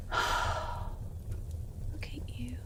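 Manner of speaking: very slow, breathy whisper drawn out; creepy tender whisper over the bound captive. Acted. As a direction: linger over each word in a whisper.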